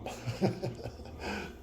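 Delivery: soft, helpless chuckle; real breathy laugh. Real, not acted. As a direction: laugh quietly despite yourself. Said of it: "I don't know why I'm laughing".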